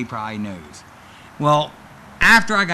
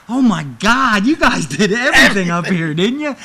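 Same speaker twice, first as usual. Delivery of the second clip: excited boss voice; 6 semitones higher, 7 dB louder than usual. Retold or imitated. WWE boss thrilled on the phone.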